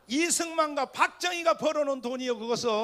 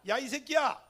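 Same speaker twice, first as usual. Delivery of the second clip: louder, higher, emphatic comeback; forceful retort; 4 semitones higher, 4 dB louder than usual. Shown as a demonstration. Preacher showing Jeolla listeners how to answer an insult, forceful retort. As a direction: throw it back with force. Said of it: "이렇게 힘을 주라".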